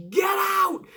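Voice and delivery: high yelled command; screaming get out. Retold or imitated. Lana screaming to Aiden English, high shrieked order. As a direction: shriek it so the nosebleeds hear. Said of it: "screaming to Aiden English".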